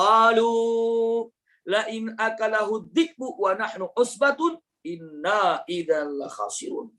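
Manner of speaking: slow, chanted Arabic recitation; measured Quranic chant. Acted. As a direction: recite the verse slowly and reverently.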